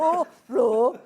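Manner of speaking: teasing roar, Brummie style; playful roar. Pretend. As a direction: echo the roar back for fun. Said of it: "animals would roar with a Brummie accent".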